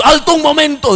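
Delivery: retold pastor's sudden halt; pastor calling halt. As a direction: call someone back abruptly. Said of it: "en eso el pastor dice".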